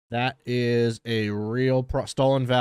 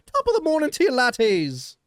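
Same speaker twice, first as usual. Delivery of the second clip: high, loud hammed-up Irish accent; over-the-top Irish greeting, pitched way up; Jacksepticeye Irish accent; 12 semitones higher, 5 dB louder than usual. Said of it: "I can do Jacksepticeye".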